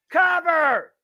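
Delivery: high, loud urgent call; shouting. Real, not acted. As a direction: yell for the pin. Urging the pin again.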